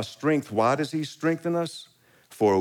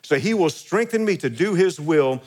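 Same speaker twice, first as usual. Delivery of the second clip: raised, driving pulpit voice; climbing preacher's cry; fervent preaching; 6 semitones higher than usual. Acted. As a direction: preach it out with full force. Preaching with rising force.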